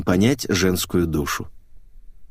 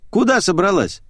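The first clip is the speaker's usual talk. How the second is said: higher, lilting female question; Natasha's voice; 10 semitones higher than usual. A narrator's reading. Natasha asks the stranded girl, higher character voice. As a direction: ask it in a raised woman's voice. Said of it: "спросила Наташа".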